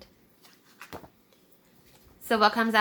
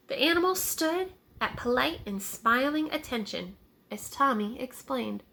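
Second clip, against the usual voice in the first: ordinary delivery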